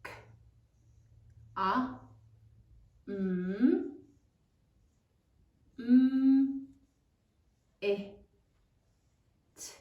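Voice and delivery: "K, a, m, m, i, t" with long gaps between sounds; sounding out. Shown as a demonstration. Class says it as they write, "K, a, m, m, i, t" slowly sound by sound. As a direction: hum out each "m" and isolate every sound of "K, a, m, m, i, t". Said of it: "say it as you write it".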